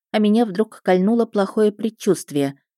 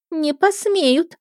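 put-on much higher, slow girlish voice; frowning mermaid; 11 semitones higher, much slower than usual. A narrator's reading. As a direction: draw it out in sulky defiance. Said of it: "нахмурилась русалка". (хмурая юная русалка)

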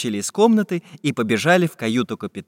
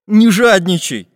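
scolding; 6 semitones higher, 6 dB louder than usual. A narrator's reading. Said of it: "погрозил пальцем Карандаш".

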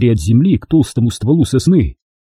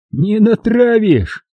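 hysterical laugh; 10 semitones higher, much slower than usual. A narrator's reading. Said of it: "Истерично засмеялся Макс".

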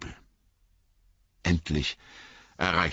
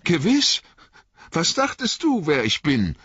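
Merry's voice; 13 semitones higher, 5 dB louder than usual. Acted. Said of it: "sagte Merry".

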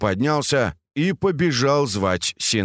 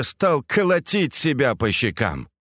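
usual speaking voice

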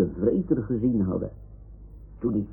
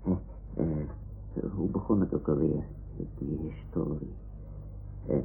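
low, soft, fast mutter; muttering to herself; 5 semitones lower, 12 dB quieter than usual. Acted. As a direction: mumble while searching for the start. Mompelend zoeken naar het begin. (binnensmonds mompelend)

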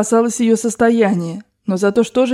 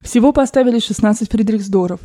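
ordinary delivery, nothing marked

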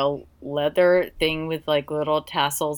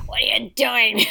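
higher, hammed-up Australian voice; Australian accent; 4 semitones higher than usual. Writer imitating Yabai in her head, mock Australian accent. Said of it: "Is that your Australian accent?".

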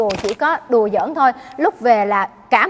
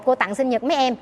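ordinary delivery

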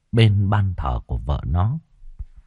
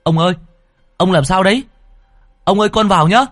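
much higher, louder anxious calling; urgent calling; 11 semitones higher, 5 dB louder, faster than usual. Acted. Servant calls urgently to his master through the door. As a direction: call through the door, worried. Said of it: "liền vội vàng gọi".

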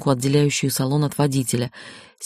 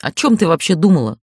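mild angry outburst, jumping up; barked reproach; 4 dB louder than usual. A narrator's reading. Man scolds her for carelessness, mildly barking. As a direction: snap at her in exasperation. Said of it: "рявкнул он".

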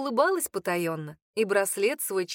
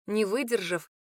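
plain reading voice

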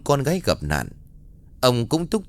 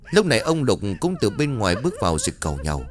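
usual speaking voice

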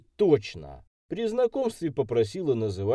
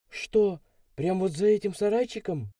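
high-pitched, mildly horrified disbelief; horrified question; 9 semitones higher than usual. A narrator's reading. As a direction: ask as the girl, taken aback. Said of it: "с ужасом в глазах уточнила".

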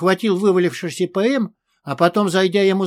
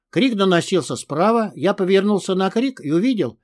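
ordinary delivery, nothing marked

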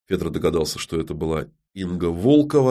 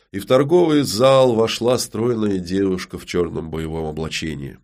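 plain reading voice

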